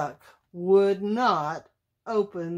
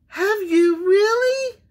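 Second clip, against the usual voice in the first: high, loud duck voice; high-pitched, incredulous duck; questioning mother duck; 12 semitones higher, 6 dB louder than usual. A narrator's reading. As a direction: ask in eager disbelief. Mother duck asks doubtfully.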